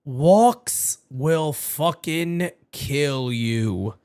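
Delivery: ordinary talk, nothing marked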